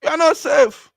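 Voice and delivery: high-pitched, loud outcry; emphatic warning. Real, not acted. Host warning listeners no place is safe, emphatic outcry. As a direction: cry out the warning far above normal pitch. Alarmed warning after the news.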